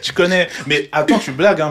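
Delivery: high laughing through the words; amused, cracking up. Real, not acted. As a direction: friend laughs at the T-shirt joke. Laughing reply.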